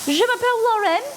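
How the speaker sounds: very high, hammed-up French accent; mock French accent. Host answering what accent that is, mock French accent. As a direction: squeal out a cartoonish French introduction. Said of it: "What hell is that accent?".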